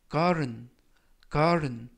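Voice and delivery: garden with the t softened to a light r; soft flapped t. Shown as a demonstration. Teacher models the soft t in garden for students. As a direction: say garden with a gentle r in place of t. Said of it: "T 발음이 부드럽게 트가 아니라 르".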